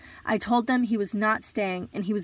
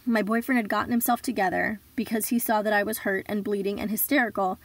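normal talking voice

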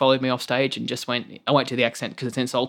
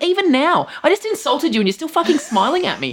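much higher, amazed disbelief; incredulous; 13 semitones higher than usual. Retold or imitated. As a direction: marvel that they're still smiling. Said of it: "I'm like".

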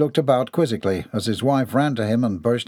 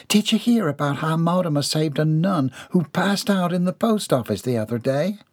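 a lightly higher gossiping maid; chatty maid; 6 semitones higher than usual. Acted. Maid Milly gossiping to the trio, lightly higher. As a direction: lean in with eager gossip.

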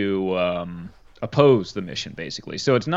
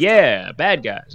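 louder, cheerful yes; eager yes; 5 semitones higher, 6 dB louder, slower than usual. Retold or imitated. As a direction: blurt it out eagerly. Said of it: "And he's like".

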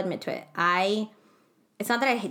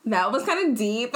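high, loud laugh that runs into the words; 10 semitones higher, 5 dB louder, much slower than usual. Real, not acted. Brushing off a heavy story with a cohost, loud laughing. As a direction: laugh it off after the sad story.

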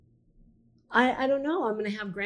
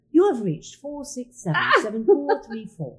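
higher, louder recorded-greeting read of the number; answering machine voice; 5 semitones higher, 5 dB louder than usual. Retold or imitated. Showing a friend her mom's answering machine greeting, recorded-message style. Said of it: "I can do".

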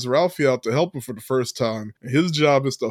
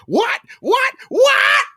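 high and getting higher, shout the last one; rising outraged shriek; 5 dB louder, much slower than usual. Retold or imitated. Podcaster playing Schwartz's mom exploding on the phone, rising to a shout. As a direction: build to a shriek of disbelief.

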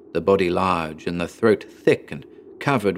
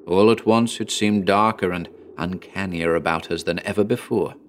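plain reading voice

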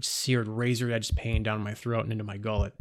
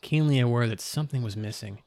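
plain reading voice

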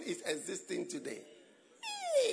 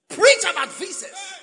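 high, loud preacher's cry; full-force pulpit shout on visas; preaching shout; 8 semitones higher, 10 dB louder than usual. Shown as a demonstration.

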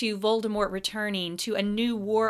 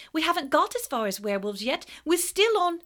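fast, high protest; high hurried schoolgirl; 8 semitones higher, 5 dB louder than usual. A narrator's reading. Parvati explaining to Snape, quick and high. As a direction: rush it out. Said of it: "said Parvati suddenly".

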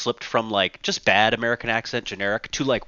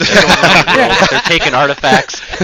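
mock outrage; 5 semitones higher, 9 dB louder than usual. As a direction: rail against the British, louder than before, tongue in cheek. Mock rant about British tomb raiders.

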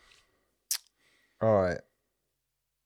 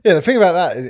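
loud, higher butting in; eager interruption; 8 semitones higher, 13 dB louder, faster than usual. Acted. Friend jumps in on an anecdote, loud interruption. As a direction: cut in loudly over someone. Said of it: "and you'll go".

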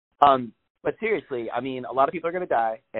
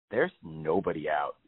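hushed disbelief; 9 semitones lower, 5 dB quieter than usual. Retold or imitated. Stunned view of empty street.

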